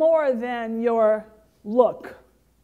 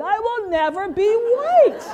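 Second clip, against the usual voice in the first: laughing; 7 semitones higher, 6 dB louder than usual. Real, not acted. Punchline repeat, laughing.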